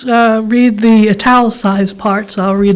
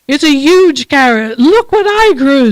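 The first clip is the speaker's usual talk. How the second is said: a hint of a higher, boastful bunny; lightly voiced proud bunny; 4 semitones higher than usual. Bunny boasting to its neighbour, lightly voiced and proud. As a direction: brag about the carrot, a little lifted. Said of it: "says one bunny".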